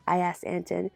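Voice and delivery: plain reading voice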